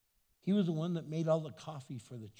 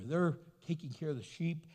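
ordinary delivery

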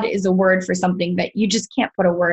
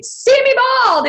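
much higher and louder, a playful pretend shout; mock triumphant cry; 4 dB louder than usual. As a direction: sing it out like a carefree parade. Imagined triumphant street cry.

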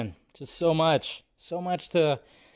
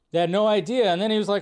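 usual speaking voice

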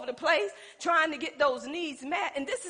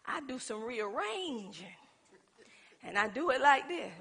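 ordinary talk, nothing marked

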